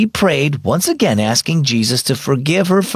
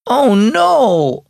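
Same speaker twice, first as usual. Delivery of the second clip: high, louder disappointed wail; 13 semitones higher, 4 dB louder than usual. Acted. Aubrey wailing to Mom about missing the shopping trip, high and louder. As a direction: cry out at the missed outing. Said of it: "Aubrey wailed".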